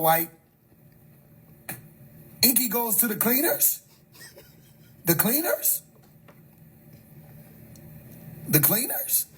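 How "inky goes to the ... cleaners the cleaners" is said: high, soft questioning with rising ends; baffled, pitched-up question; incredulous. Real, not acted. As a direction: echo it in rising disbelief. Disbelief at Inky using the cleaners.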